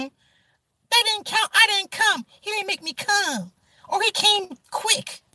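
hammed-up high whiny excuse; mocking woman's excuse. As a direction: sneer her excuses back in a high voice.

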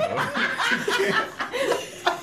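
squealing high laugh. Real, not acted. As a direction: burst into giggles. Laugh burst.

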